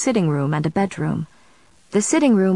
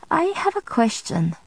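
careful, higher-pitched opening; 6 semitones higher than usual. A narrator's reading. Friend waits on the next move, carefully raising a question. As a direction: raise it gingerly, a touch tentative. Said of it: "His voice was careful as he waited".

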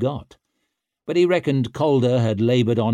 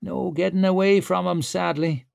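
muttered; 7 semitones higher than usual. Acted. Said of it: "muttered Clover".

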